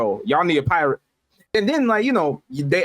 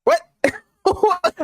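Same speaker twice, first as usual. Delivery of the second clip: high, laughing disbelief; baffled what. Real, not acted. Friend hears the odd tagline, laughing disbelief. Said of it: "baffled at the tagline".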